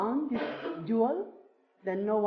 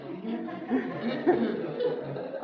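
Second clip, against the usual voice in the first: real burst of higher-pitched laughter; 3 semitones higher than usual. Real, not acted. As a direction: break up laughing. Amused at own limited English.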